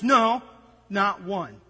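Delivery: normal talking voice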